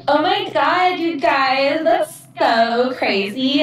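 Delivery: put-on high, gushing Valley Girl drawl; over-the-top Valley Girl millennial. Shown as a demonstration. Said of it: "a super Valley Girl millennial voice".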